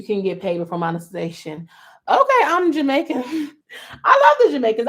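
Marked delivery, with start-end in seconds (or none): 2.1-4.9 high, loud, laughing excitement; real excited laughter. Real, not acted. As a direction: gush about it, laughing. Excited reaction to a guess.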